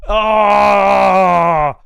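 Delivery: a short, far-off cry of frustration; frustrated yell. Real, not acted. Streamer upset at being left off the list, frustrated yell. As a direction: let out a sharp frustrated ah.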